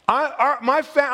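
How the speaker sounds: ordinary talk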